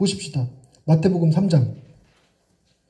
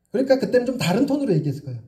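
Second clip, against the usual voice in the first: ordinary talk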